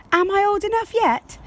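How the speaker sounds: put-on high, quick child's voice asking; a small girl begging to be grown up; eager little girl. Josie asks her parents, high eager child voice.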